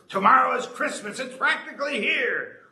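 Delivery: high, loud sneering Grinch; snarling Grinch. A narrator's reading. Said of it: "he snarled with a sneer".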